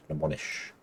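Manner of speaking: usual speaking voice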